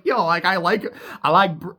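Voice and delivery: usual speaking voice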